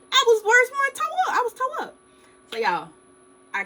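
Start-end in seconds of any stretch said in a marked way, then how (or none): 0.1-1.9 squeaky high-pitched overstatement; much higher, indignant emphasis on being hurt worst; exaggerated emphasis. Real, not acted. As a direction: jump way up in pitch to sell how bad it was. Stressing how badly hurt.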